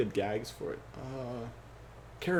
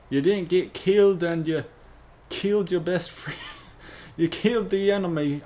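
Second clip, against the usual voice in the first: Wally's bad toast; 6 semitones higher, 5 dB louder than usual. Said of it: "So it'll be like".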